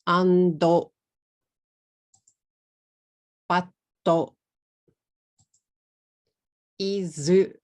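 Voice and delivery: "ando, batto, izu" with a vowel added after each final consonant; two even beats per word, Japanese-style vowels; katakana English. Shown as a demonstration. Teacher showing learners the mistake, katakana English. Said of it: "say these words in katakana English".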